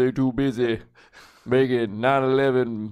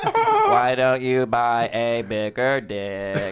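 slow, dopey robot voice; dumb robot voice; much slower than usual. Pretend. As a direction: ask it like a clueless robot. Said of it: "It's the robot".

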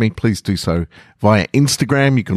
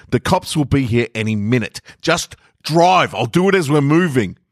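much higher, fast, impatient voice; angry passenger; 8 semitones higher than usual. Acted. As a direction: snap at the driver to just go. Passenger urging haste.

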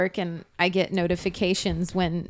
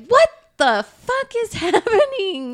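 high, laughing disbelief; baffled; 13 semitones higher, 5 dB louder than usual. Retold or imitated. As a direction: squeal out confused disbelief. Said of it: "And I was like".

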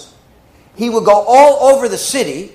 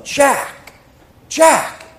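high, pleading calls of Jack; frightened cry; 6 semitones higher than usual. Retold or imitated. Sister waking from a nightmare, calling her brother frightened. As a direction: call out his name in fright.

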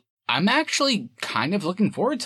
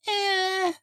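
unimpressed eh. Pretend. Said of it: "Or are you kind of like".

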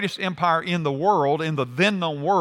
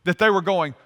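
ordinary delivery

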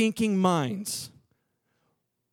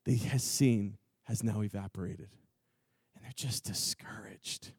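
fading to a whisper; 13 semitones lower, 11 dB quieter than usual. Acted. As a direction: drop from low and soft into a breathy whisper. Sombre dropping to whisper.

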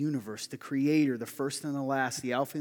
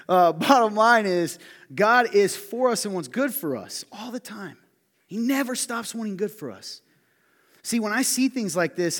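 loud emphatic preaching; 8 semitones higher, 10 dB louder than usual. Acted. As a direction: drive the point home to the congregation.

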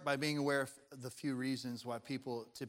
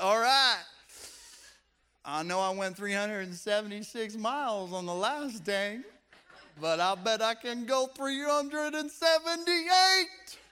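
louder, higher hammed-up driver bragging; competitive driver; 7 semitones higher, 9 dB louder than usual. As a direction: play the record-chasing driver, growing cocky. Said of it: "we're like".

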